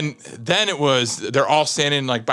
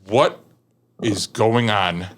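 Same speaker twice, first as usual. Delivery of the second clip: stern disbelief; 6 semitones lower, slower than usual. Retold or imitated. Commander confronts him.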